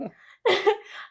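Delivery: loud, high burst of laughter; real laugh. Real, not acted. Woman breaks up retelling a car quarrel, laughing loudly.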